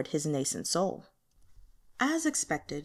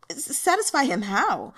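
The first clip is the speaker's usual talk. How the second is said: startled stammer, pitched up; hoarse stammer; 5 semitones higher, 3 dB louder than usual. Acted. Yin Hanjiang asks Wen Zhen, stammering and startled. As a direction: stumble over it in shock. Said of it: "his voice hoarse".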